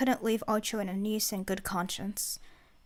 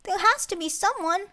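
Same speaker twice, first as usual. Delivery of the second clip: put-on high, loud kid insisting; insistent child voice; 10 semitones higher, 5 dB louder than usual.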